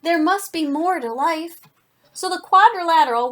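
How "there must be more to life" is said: higher-pitched, emphatic storybook lament; lightly acted fed-up declaration; grumbling complaint. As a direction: complain it upward, for children. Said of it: "it grumbled".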